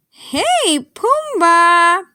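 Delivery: high, loud call to a friend; bright high holler to get attention. Acted. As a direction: hail him from far off. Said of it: "I called".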